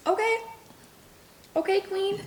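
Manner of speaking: mock-impressed okay queen; sassy okay queen. Mock reply to bread bragger.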